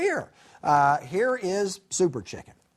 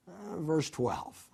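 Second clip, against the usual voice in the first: ordinary talk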